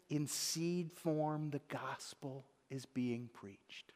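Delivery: slow whispered wonder; awed whisper. Real, not acted. Preacher marveling to the congregation, slow and whispered. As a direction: breathe it out in awe.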